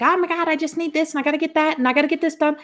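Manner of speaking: high-pitched, hurried rattle of demands; frantic rushing. Acted. Acting out someone constantly rushing, high and frantic. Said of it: "when you're in the rush".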